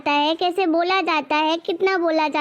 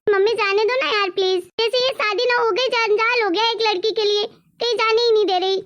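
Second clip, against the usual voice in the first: higher, nagging plea that turns into a grumble; exasperated daughter pleading; whiny daughter begging; 4 semitones higher than usual. Acted. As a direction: beg mom, then complain under it. Daughter begs, then grumbles.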